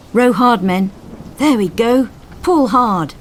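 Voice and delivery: loud encouraging call; rallying shout. Acted. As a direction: push the crew through the last waves. Coxswain urging crew to shore.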